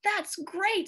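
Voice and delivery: high delighted exclamation; excited exclaim. Acted. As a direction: cheer at the new snow. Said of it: "Strawberry exclaimed".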